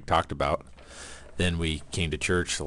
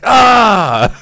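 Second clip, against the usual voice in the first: high, much louder startled cry; surprised shout; 14 dB louder than usual. Retold or imitated. Learning Greg got there first, a startled mock yell. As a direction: yelp as if caught out.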